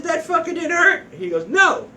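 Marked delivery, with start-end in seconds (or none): 0.0-1.1 much higher, disbelieving voice; acted astonishment; incredulous question. Incredulous question in retold exchange. 1.5-1.9 high, loud acted "no"; exclaimed retort; emphatic denial. Navy man's acted reply.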